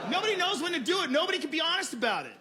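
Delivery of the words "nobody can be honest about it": normal talking voice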